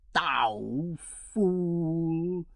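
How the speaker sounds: mild mock shriek of an old fiend; understated furious hag cry. A narrator's reading. Witch answers the king, understated shriek. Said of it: "shrieked the old fiend".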